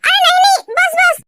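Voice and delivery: ordinary talk, nothing marked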